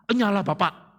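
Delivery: mock-scold the pastor; mock rebuke. Imagined congregant rebukes pastor.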